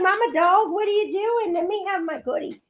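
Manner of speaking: put-on high, childlike dog voice; high pleading puppy voice begging for a treat; voicing the dog. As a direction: speak for the pup like a whiny kid. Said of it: "She's like".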